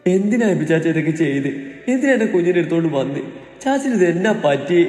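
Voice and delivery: high, pleading woman, fear rising; frightened young woman. A narrator's reading. Terrified woman asks why he took her baby.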